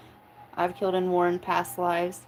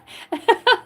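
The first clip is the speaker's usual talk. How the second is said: shrieking giggle, pitched way up and loud; high squealing laugh; 13 semitones higher, 5 dB louder than usual. Real, not acted. Cracking up at the chat with a high, loud squeal. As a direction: let the laugh fly up into a squeal.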